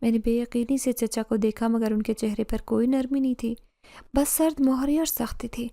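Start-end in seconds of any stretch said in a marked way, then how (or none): none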